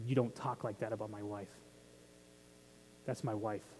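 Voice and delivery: low, soft and firm, protecting his wife; low protective warning. Retold or imitated. Jesus defending his bride, a low and soft warning. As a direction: quietly warn them off, like a husband defending his wife. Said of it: "He's defending his wife".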